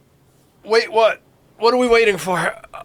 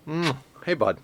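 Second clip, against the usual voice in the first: ordinary delivery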